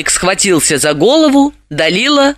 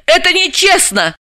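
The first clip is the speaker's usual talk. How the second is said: high-pitched indignant heroine; protest, voice shooting up; outraged, high exclamation; 13 semitones higher than usual. A narrator's reading. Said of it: "возмутилась Далила".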